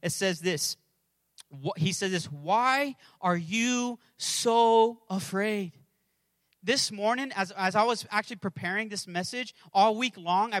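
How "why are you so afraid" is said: drawn out word by word; very slow scriptural challenge; slow, weighty question. Acted. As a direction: stretch each word and let the question sink in. Preacher quotes Jesus' question slowly.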